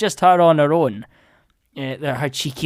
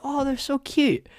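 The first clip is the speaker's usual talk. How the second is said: high, gushing fan voice; high mock adoration, softening toward the end; 9 semitones higher, 3 dB quieter than usual. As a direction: gush like a besotted viewer. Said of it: "you're totally like".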